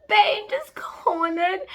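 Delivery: slow breathless whisper. Real, not acted. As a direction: hush it like juicy gossip. Breathless gossip about scene.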